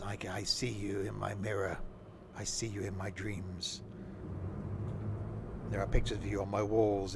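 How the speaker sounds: low, soft, creepy voice to passengers; creepy driver. Pretend. Driver teasing the passengers, mock creepy. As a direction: creep them out, softly and low. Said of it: "Is that creepy?".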